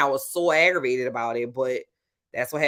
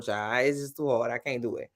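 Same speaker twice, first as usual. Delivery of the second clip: ordinary talk, nothing marked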